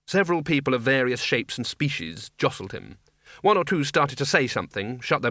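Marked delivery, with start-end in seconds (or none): none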